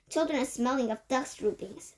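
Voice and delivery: plain reading voice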